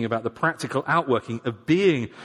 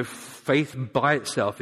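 ordinary delivery

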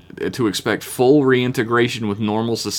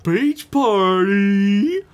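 high, drawn-out silly Brack voice; hammed-up captain voice saying beach party; 10 semitones higher, much slower than usual. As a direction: stretch it out way up high, slowly. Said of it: "he referred to it as".

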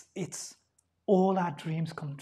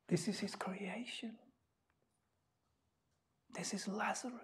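whispered, much softer; solemn hush; 16 dB quieter than usual. Acted. Preacher to congregation about Lazarus, hushed whisper. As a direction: drop to a reverent whisper.

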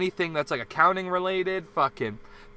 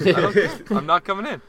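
laughing; 4 dB louder than usual. Retold or imitated. Laughing through retold refusal.

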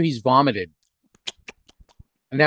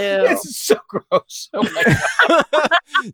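high, drawn-out disgusted laughter; slow, high words through real laughter; grossed-out laughing; 11 semitones higher, much slower than usual. Real, not acted. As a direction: laugh helplessly while revolted.

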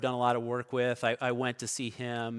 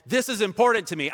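loud, higher, forceful declaration; said like you mean it; 8 semitones higher, 7 dB louder, faster than usual. Retold or imitated. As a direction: say it with full conviction, loud and fast. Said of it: "say it like you mean it".

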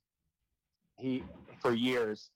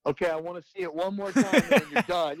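lightly imitated captain, higher and louder; raised, mock-stern captain voice; dad voice; 7 semitones higher, 6 dB louder than usual. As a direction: lay down the rule like a dad to his crew. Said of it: "when he'd put on his dad voice".

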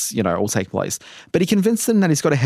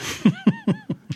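high laugh; 3 dB quieter than usual. Real, not acted. Laughs at Alfred joke.

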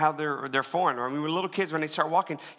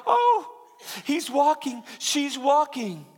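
high, loud, gushing excitement; acted thrill, voice pitched way up; delighted cheering parent; 12 semitones higher, 4 dB louder, slower than usual. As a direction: squeal it like a proud parent at first steps. Parents cheering a toddler's first steps.

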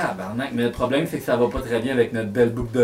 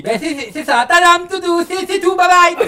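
high, loud flustered brush-off; embarrassed magician; 14 semitones higher, 4 dB louder than usual. Acted. Marlin shooing the visitor out, high and flustered. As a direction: hurry them away with a nervous laugh. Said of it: "puis je dis".

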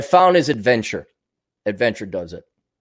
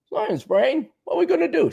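higher, excitable cartoon sidekick; Pinky impression; 10 semitones higher than usual. To his brain, a higher silly Pinky voice. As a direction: play the silly sidekick asking Brain the plan.

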